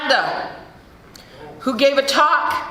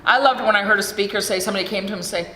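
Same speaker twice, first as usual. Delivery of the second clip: usual speaking voice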